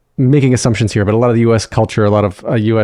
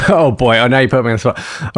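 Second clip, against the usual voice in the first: ordinary talk, nothing marked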